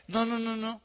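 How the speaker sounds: usual speaking voice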